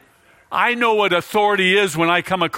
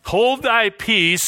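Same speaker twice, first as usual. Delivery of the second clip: slow stern command; 5 semitones higher, much slower than usual. Shown as a demonstration. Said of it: "Jesus orders him like a man orders a dog".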